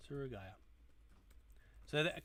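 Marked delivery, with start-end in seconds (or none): 0.1-0.5 Surugaya repeated softer, falling, as if to himself; quiet echo. Shown as a demonstration. Repeating the shop name to himself, softly. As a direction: echo Surugaya under your breath, softer. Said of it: "walk to Surugaya".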